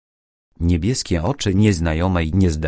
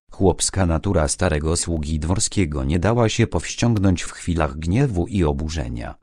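plain reading voice

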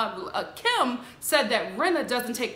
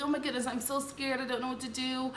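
soft, small fake fright; mock scared; 9 dB quieter than usual. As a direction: play the helpless victim quietly. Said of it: "she's like".

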